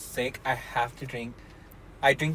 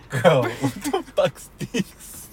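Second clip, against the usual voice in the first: laughing; 2 semitones higher, 5 dB louder, much slower than usual. Retold or imitated. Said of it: "I was just one day like".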